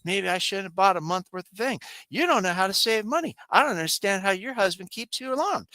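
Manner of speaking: a hint of an exasperated wife; mock bickering wife. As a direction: scold him like a nagging spouse. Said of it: "start arguing like an old married couple".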